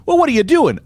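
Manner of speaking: high-pitched mock indignation; exasperated challenge. Public challenges billionaire's hero act, high and exasperated. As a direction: throw it out high, acting incredulous.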